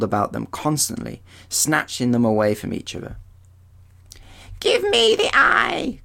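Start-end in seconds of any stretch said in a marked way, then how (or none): 4.6-6.0 very high, thin crone voice crying out; shrill hag's cry. Acted. Grey sister cries out to her sisters in a high hag voice. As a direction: screech it like a grasping old hag. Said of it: "cried one".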